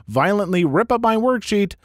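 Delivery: ordinary delivery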